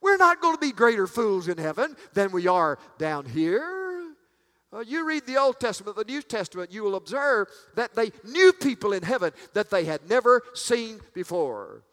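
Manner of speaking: high, punchy preacher's voice; forceful preaching. Real, not acted. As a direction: drive it home to the congregation.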